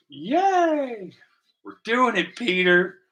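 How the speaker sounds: high, bright little cheer of triumph; excited cheer. Real, not acted. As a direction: celebrate like you just nailed it. Cheering his own paint job.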